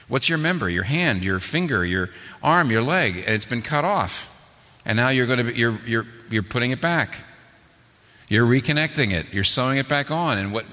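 ordinary talk, nothing marked

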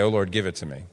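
ordinary talk, nothing marked

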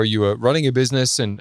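ordinary talk

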